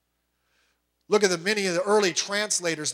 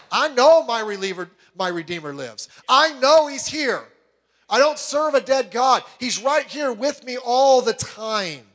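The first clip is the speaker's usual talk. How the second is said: Job declaring faith; 5 semitones higher, 5 dB louder than usual. Retold or imitated. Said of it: "he says".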